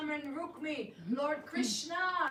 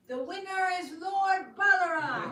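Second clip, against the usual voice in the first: voice from the sky; 5 semitones higher, 10 dB louder than usual. Acted. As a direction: proclaim it like a voice from heaven. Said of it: "a voice from the sky was heard".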